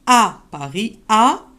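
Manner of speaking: À set apart and punched before Paris; the À stressed on its own. Shown as a demonstration. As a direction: hit the À alone. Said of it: "il y a l'accent sur le A".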